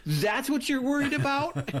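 very high, mock-exasperated question; incredulous comeback. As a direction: act out disbelief at a petty gripe, pitch way up. Acting out comedian's incredulous reply.

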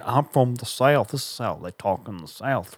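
slow, stretched mock Southern accent; fake Southern accent. Mocking actors who fake the South, exaggerated Southern accent. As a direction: overdo the Southern vowels, especially on "South". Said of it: "That's someone who's saying".

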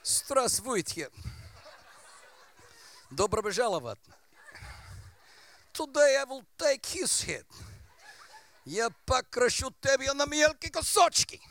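hammed-up Russian villain accent. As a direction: play a Russian strongman in Russian accent. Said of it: "in a Russian accent".

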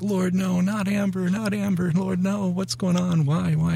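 high, pleading cry to God, voice rising; anguished crying plea. Retold or imitated. Grandfather hearing the baby drowned, pleading high and anguished. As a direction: retell the moment of begging God, near tears. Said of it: "And I'm crying, and I'm weeping".

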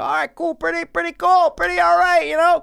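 high, loud mock British bloke; mock British accent. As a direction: put on a hammy British accent. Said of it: "definitely British".

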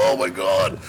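a touch of tearful wailing, retold; mock crying. Storyteller recalls being too stoned to talk, mock crying. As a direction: imitate your own helpless crying. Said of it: "I'm, like, crying".

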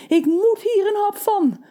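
high eager baker; drooling baker. A narrator's reading. The hungry baker sniffing the kettle, high and eager. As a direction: stammer it, mouth watering.

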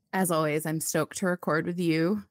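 normal talking voice

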